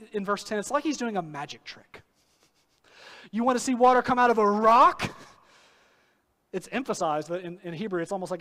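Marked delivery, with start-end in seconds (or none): 3.3-5.1 loud, raised taunting yell, voice up high; angry yell. Retold or imitated. Moses mocking rebels, shouting loud at them. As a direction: hurl it at the crowd like a dare. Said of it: "yells at the people".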